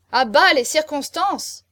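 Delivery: high, loud, drawn-out jeer; heckling shout. A narrator's reading. Guests cut off the marquis's next argument, heckling. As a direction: shout it down, stretching the words.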